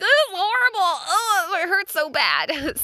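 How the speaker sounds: high whimpering wail, slowing on the last words; hammed-up tearful whimper over a scraped elbow; mock crying. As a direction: whine it out, half crying. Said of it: "I'm, like, tearing up".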